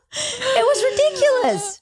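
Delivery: high laughing, near tears; laughing hard. Real, not acted. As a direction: laugh till it almost cries. Laughing at embarrassing story.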